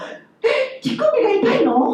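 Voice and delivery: high, alarmed mishearing; mock shock at what she heard; shocked question. Mother mishearing daughter, high alarmed question. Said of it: "To my daughter said".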